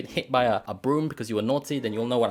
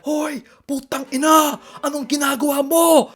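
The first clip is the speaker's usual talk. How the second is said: angry shout; 10 dB louder than usual. Retold or imitated. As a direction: bellow it across a silent room. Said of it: "Like that, not to me though".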